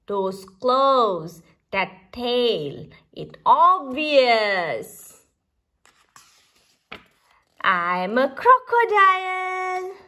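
ordinary delivery

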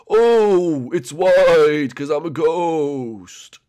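loud, slow, wailing ghost voice; high start, long ghostly drawl; cartoon ghost. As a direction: stretch the words out like a spook. Ghost Blimpy describes room.

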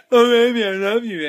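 high, loud swooning mockery; mock lovesick Rory. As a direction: ham up a moony declaration. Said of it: "Rory going".

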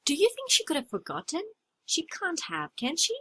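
ordinary talk

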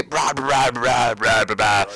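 rough crashing sound effects with the voice; imitated crash noise. Driver showing how the simulator crash went, imitated crash. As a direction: make the wreck noises, burst after burst. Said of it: "se vio el pinche rock así de que".

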